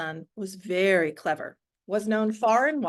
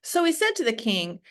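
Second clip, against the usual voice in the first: plain reading voice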